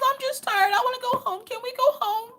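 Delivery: very high-pitched mock whimper; imitating tearful child. Upset daughter asks mom to go home, imitated tearful whine. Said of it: "with tears in her eyes".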